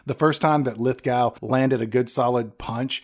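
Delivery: ordinary talk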